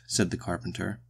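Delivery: ordinary delivery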